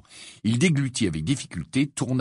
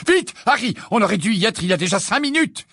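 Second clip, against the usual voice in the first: hurried urgency; 13 semitones higher, 5 dB louder than usual. Acted. Mr Weasley hurrying.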